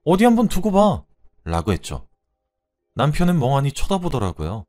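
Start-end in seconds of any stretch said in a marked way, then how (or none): 0.0-1.0 loud, much higher, rising rage; furious tirade. Acted. Wife raging at husband for taking out stress. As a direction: unload everything on him.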